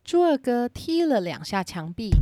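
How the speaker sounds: ordinary delivery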